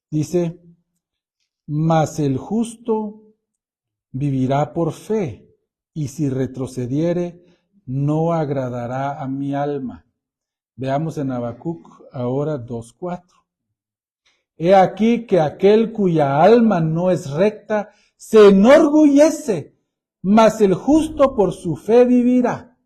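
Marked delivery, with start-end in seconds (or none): 1.7-10.0 slow, weighty reading of the verse; solemn scripture reading. Acted. Pastor reading Habakkuk to the congregation, slow and solemn. 14.6-22.8 rising, louder scripture reading. Acted. Pastor proclaiming Habakkuk to the church, louder and rising. Reads Habakkuk two four.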